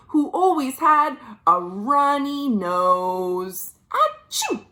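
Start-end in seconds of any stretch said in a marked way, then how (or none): 3.9-4.6 much higher mock sneeze; playful sneeze. Reader acting the rhinoceros's runny nose, playful mock sneeze. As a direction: play a big silly rhino sneeze.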